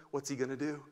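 ordinary talk

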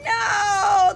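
high, loud cry of no. Acted. Teacher protesting in the story, loud high no. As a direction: protest for the kids.

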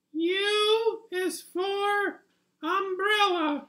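very high, silly little puppet voice reading the alphabet slowly; a tiny cartoon critter sounding out letters; squeaky puppet voice. Puppet hosting its alphabet show, very high squeaky voice.

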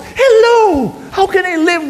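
very high, loud exclamation; indignant cry. Acted. Older brother protesting to his father, indignant. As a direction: fling it out in outrage.

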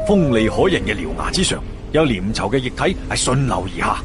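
ordinary delivery, nothing marked

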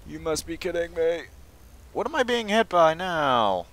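high, loud wail of protest; exasperated yell. Real, not acted. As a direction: yell at unseen attackers, pitched high. Under attack in game, exasperated.

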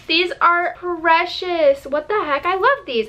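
high, stretched-out delight; delighted gushing. Real, not acted. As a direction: gush over a precious gift. Delighted by gift bracelets.

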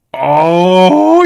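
loud awed oh; awed exclamation. Real, not acted. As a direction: let out a loud impressed oh. Awed by the new weapon.

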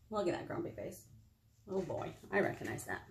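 ordinary delivery, nothing marked

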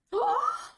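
sharp, very high-pitched gasp; exaggerated gasp. Reacting to the pee-pee check, exaggerated high gasp.

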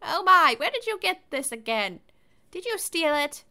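put-on old lady voice. Granny scolding gently.

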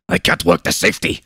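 fast, breathless panicked babble; babbling panic. A narrator's reading. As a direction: rattle it out in a fluster. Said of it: "the Commissar babbled".

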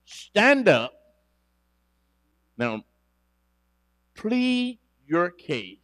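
loud, slow preacher's proclamation; preaching scripture. Acted. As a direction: thunder the verse out to the church. Preacher reads scripture, full force.

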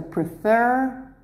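"prefer" drawn out slowly on the final fer; stress on last syllable. Shown as a demonstration. Teacher shows where the stress falls, "prefer" stressed on fer. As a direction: lean long on the end of "prefer". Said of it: "the stress is at the end".

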